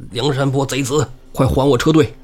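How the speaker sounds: retold indignant yell, held in check; urgent angry demand. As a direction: call after fleeing robbers, mildly hammed-up. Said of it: "卢俊义就急了".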